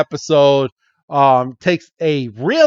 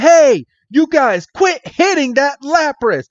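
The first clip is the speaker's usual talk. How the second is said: higher, louder call across the beach; 9 semitones higher, 3 dB louder than usual. Retold or imitated. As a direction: shout at the bullies to stop. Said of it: "they're like".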